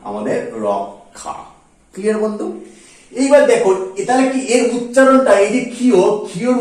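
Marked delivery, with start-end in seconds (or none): 0.7-1.3 রক্ষা low, soft and slow as rok-kha; k-kh pronunciation. Shown as a demonstration. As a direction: split রক্ষা softly into rok, then kha. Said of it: "হয়ে যাবে কিন্তু ক খ".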